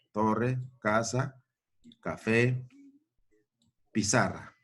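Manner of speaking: "Torre, casa" spoken slowly, word by word; slow dictation. Shown as a demonstration. Teacher dictating slowly to pupils. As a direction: drag out "Torre, casa" for the class. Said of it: "Todas estas palabras terminan en".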